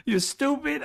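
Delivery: high mocking squeal; squeaky mock Fuentes. Vlogger mimicking pundit calling Trump stupid, high voice. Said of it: "He said".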